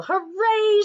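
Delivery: high, loud cheer of a crowd. Acted. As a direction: throw your voice up in a joyful hooray. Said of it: "shouted the people".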